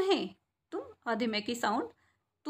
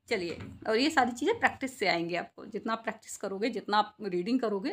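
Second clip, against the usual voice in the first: usual speaking voice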